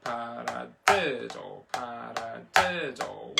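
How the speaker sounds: paradiddle chanted slowly, hitting "di"; slow, measured count with the third syllable punched; accent on third syllable. Shown as a demonstration. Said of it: "still catching all those accents".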